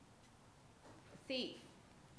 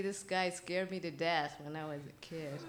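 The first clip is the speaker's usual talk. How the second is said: usual speaking voice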